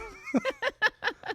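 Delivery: high burst of laughter; high squeal laugh. Real, not acted. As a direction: laugh out loud, high and bright. Laughs at question.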